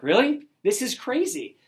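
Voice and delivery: recalling the moment the extra order came in; surprised disbelief. Retold or imitated. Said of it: "I'm like".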